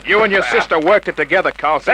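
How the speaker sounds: loud, fast, high accusation mid-struggle; forceful accusation. Acted. Detective pinning the killer down, loud fast accusation. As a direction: nail him while holding him down.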